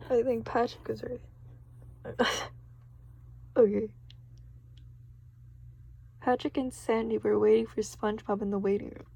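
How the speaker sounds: plain reading voice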